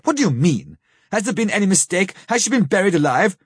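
rising, pleading alarm; anguished. A narrator's reading. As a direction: voice climbing in dread. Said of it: "He groaned in anguish".

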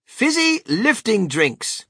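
high, loud, slow announcement; reading out a sign. A narrator's reading. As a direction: proclaim the label grandly.

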